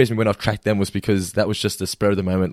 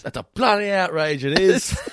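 high, put-on Australian drawl of outrage; mock indignant Australian voice; exaggerated Aussie accent; 8 semitones higher, slower than usual. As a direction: ham up a cartoon Australian. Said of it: "Australian accent that they do".

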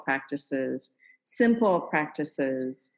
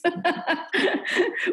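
a burst of high laughter; high bright laugh; 12 semitones higher than usual. Real, not acted. Host laughs at her confession.

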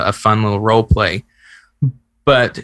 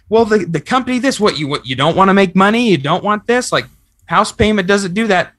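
defensive retort; 9 semitones higher than usual. Acted. Spouse answering a partner's complaint defensively, in a high snap. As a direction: protest as if accused, voice pitched up.

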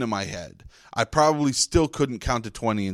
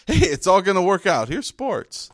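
cheery newscaster; 7 semitones higher than usual. Retold or imitated. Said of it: "a well-laid Ted Turner newscast".

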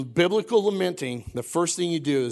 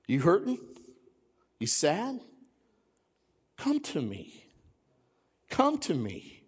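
tender voice of the Lord; 4 dB quieter than usual. Acted. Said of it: "The Lord says".

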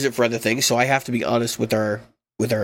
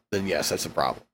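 low, soft, resigned; quiet admission; 9 semitones lower, 7 dB quieter than usual. Real, not acted.

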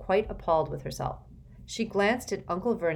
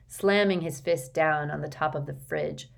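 ordinary delivery

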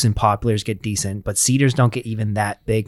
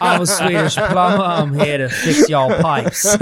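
higher, slow hammed-up Swedish plumber; mock Swedish accent; 7 semitones higher, 3 dB louder than usual. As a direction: play a cartoon Swedish plumber, pitched up. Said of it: "Can you do a Swedish accent?".

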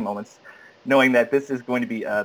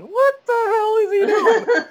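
very high, slow mock outcry; appalled disbelief; 4 dB louder, slower than usual. Panelist reacts to his profane audition, appalled disbelief. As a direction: squeal it in mock horror.

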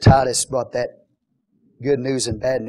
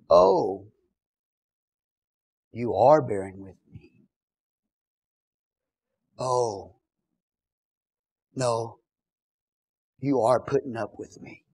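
sarcastic; 3 dB quieter, slower than usual. Acted. Paul to those putting up with him, quietly sarcastic. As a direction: feign a quiet realization, piece by piece.

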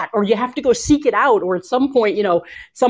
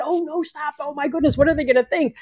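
mock panic, higher and softer; nervous fretting; 6 semitones higher, 3 dB quieter than usual. Coach imitating nervous presenters caught by a noise, mock fretting. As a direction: lightly act a flustered speaker worrying what people think. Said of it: "People get so nervous that".

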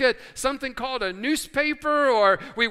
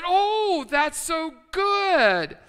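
delighted gush; 7 semitones higher, 4 dB louder, much slower than usual. Acted. Friend delighted in joke.

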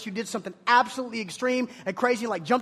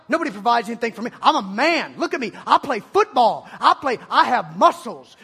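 loud, laughing macho boast; cocky tough guy; 6 dB louder than usual. Retold or imitated. Preacher mocks students, loud cocky jock boast. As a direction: puff up like a proud jock. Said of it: "You go".

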